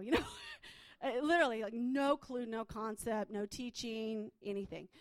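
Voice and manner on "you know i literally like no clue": ordinary talk, nothing marked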